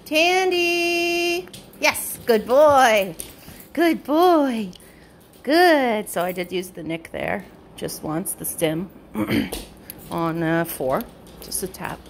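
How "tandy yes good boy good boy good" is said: much higher, sing-song praise, drawn out; dog praise voice. Real, not acted. Trainer praising her dog Tandy, high sing-song praise. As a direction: coo delighted praise at a good dog.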